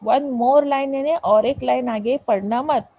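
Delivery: usual speaking voice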